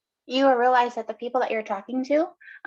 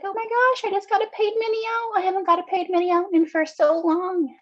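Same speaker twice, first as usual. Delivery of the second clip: high-pitched, gushing mock tearful excitement; excited, happy tears; 9 semitones higher, 3 dB louder than usual. Retelling a woman's good news, high, tearfully excited. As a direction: squeal it as if overcome with joy. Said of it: "excited, happy tears".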